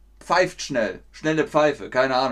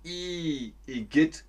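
disgusted igitt; 4 dB quieter, slower than usual. Shown as a demonstration. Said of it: "man sagt".

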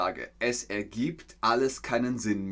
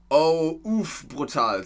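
shocked reaction; 4 semitones higher, 5 dB louder, slower than usual. Retold or imitated. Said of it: "sagt Jury".

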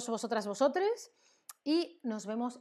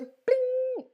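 pling sound effect; 6 dB louder than usual. Retold or imitated. Sudden wakefulness sound effect.

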